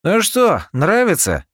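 high, loud gloating taunt; mocking. A narrator's reading. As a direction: taunt him with a grin. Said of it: "уже охмыляясь".

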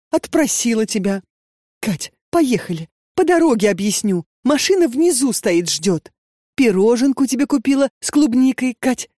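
high, urgent coaxing mother; pleading mother. A narrator's reading. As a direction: coax her daughter to hurry. Mother urges her to come.

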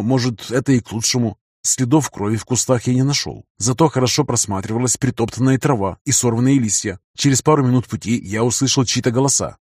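usual speaking voice

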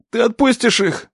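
high, insistent bargaining voice; desperate plea. A narrator's reading. Captive bargains for the young men, desperate. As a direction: plead for the others, pitched high.

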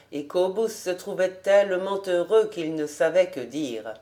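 usual speaking voice

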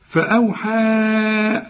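فأوحى with the last vowel held long and level; four-count elongation. Shown as a demonstration. Teacher demonstrates madd when joining, final vowel held long. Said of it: "هنمد أربع حركات".

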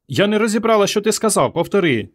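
put-on high, girlish questioning voice; young girl character. A narrator's reading. Rescue girl asks swimmer to repeat.

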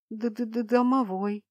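slow, frightened stutter on the first sound; stammering in fright. A narrator's reading. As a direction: stammer it out, scared stiff. Terrified stammer at house spirit.

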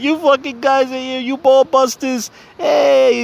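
high-pitched, hammed-up Italian guy; super Italian. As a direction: stretch it out like an excitable Italian greeting his pals. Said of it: "he's like, super Italian".

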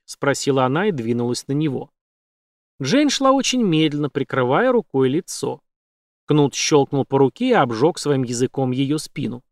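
ordinary delivery, nothing marked

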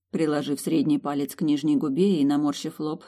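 usual speaking voice

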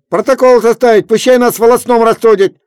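very high, loud demand; angry demand. A narrator's reading. Kuzma demands a trial, loud high demand. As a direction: insist angrily.

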